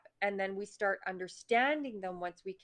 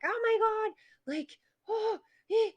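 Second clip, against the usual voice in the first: high squealed panic; retold freak out; 13 semitones higher, 4 dB louder than usual. Remembering her truck not starting, high mock panic. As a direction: shriek in mock alarm at a dead truck. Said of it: "I did that initial freak out".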